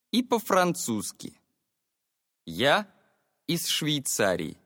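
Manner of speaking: ordinary talk, nothing marked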